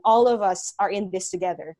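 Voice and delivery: ordinary delivery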